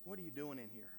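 soft, low, gentle asking; still small voice. Acted. God retrying gently with Elijah, soft and low. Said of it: "speaking a still small voice".

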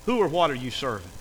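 ordinary talk, nothing marked

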